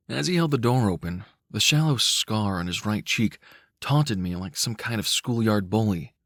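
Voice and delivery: ordinary delivery, nothing marked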